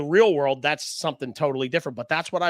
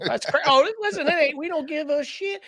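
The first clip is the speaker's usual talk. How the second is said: high excited rant; 11 semitones higher than usual. Acted. Excited high rant about Philly.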